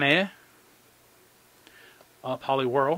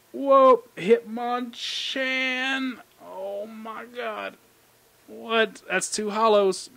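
stunned surprise; 8 semitones higher, 5 dB louder, much slower than usual. Real, not acted. Rare Hitmonchan pull.

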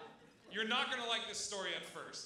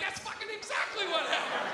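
high, loud outburst from the eavesdropper; mock exultant yell; 9 semitones higher, 6 dB louder than usual. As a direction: burst out loud and high, vindicated. Eavesdropper exulting at the accurate guess.

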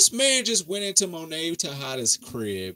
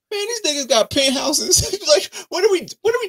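can't believe what they're seeing; incredulous outburst; 6 dB louder than usual. Real, not acted. Outraged at the rich characters.

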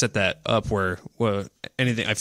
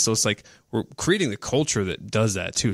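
ordinary talk

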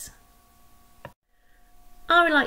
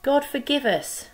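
higher pleading cry; 7 semitones higher, 4 dB louder than usual. Acted. Sailors throwing Jonah overboard, pleading cry. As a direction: beg forgiveness as you act. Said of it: "the sailors cried".

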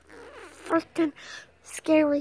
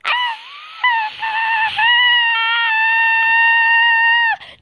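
very high shrieking cackle, louder; shrieking laugh; 4 dB louder than usual. Acted. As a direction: let out a wild shrill laugh. Shrieking laugh after a joke.

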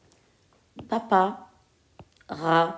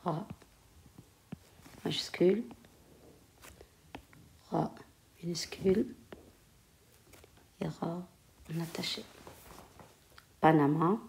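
murmuré lentement, mot après mot, en écrivant au tableau; à voix basse en écrivant; 11 dB quieter than usual. Real, not acted. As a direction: dicte tout bas pour soi, avec de longues pauses. Dictates softly while writing on board. (la maîtresse écrit au tableau et se dicte les mots, à voix basse)

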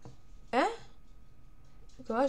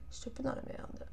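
voiceless aside to herself; whispering; 2 semitones higher, 11 dB quieter than usual. Real, not acted. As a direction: drop into a whisper. Aside while playing, voiceless.

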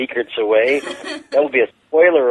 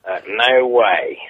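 slow, drawn-out mock Australian "no way"; a comedian faking an Aussie accent to refuse American money; hammed-up Australian accent; slower than usual. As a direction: lay on a jokey Australian accent. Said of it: "So we say no in an Australian accent".

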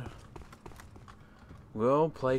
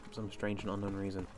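normal talking voice

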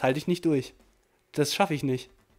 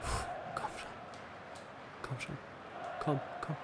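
whispered urging; 4 semitones lower, 18 dB quieter than usual. Real, not acted. Tense final minutes.

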